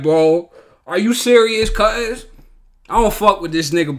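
ordinary talk, nothing marked

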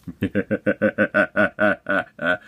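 maniacal villain cackle, held down in volume; rapid, staccato mad laugh; restrained supervillain cackle; Mark Hamill laugh. Acted. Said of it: "pretty good Mark Hamill laugh".

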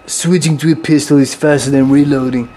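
mock British accent, slightly low. Gamer quoting a funny line to viewers, British accent. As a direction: put on a British accent for a catchphrase. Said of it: "How about my British accent?".

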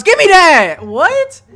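high, loud triumphant yell; excited shout. Real, not acted. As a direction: shout it in victory. Celebrating a steal.

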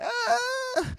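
very high mock wail of pain. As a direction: wail as if your ear is being pulled. Acts having ear pulled.

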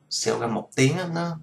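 ordinary talk, nothing marked

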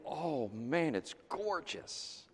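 soft, slow, lingering wonder; hushed awe. Retold or imitated. Preacher recalling the Sea of Galilee to his congregation, hushed awe. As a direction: drop right down and savor the view.

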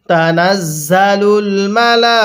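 slow chant of তানাজ্জালুল মালা; tajweed chant; slow recitation. Shown as a demonstration. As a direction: draw out মালা. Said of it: "তাহলে".

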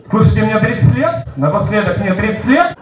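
usual speaking voice

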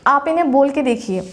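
ordinary talk, nothing marked

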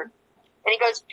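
ordinary talk, nothing marked

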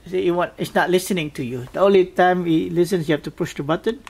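ordinary talk

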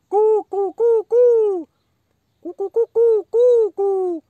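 high loud cuckoo calls. Pretend. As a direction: chirp like a cuckoo.

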